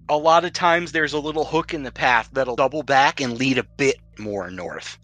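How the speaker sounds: ordinary talk, nothing marked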